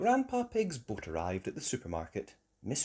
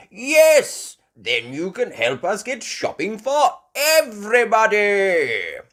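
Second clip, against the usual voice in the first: loud, hearty, raised voice; Grandpa Pig; 11 semitones higher, 11 dB louder than usual. Acted. Grandpa Pig agreeing to take them, loud and hearty. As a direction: boom it out, stretching the end.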